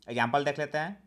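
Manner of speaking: usual speaking voice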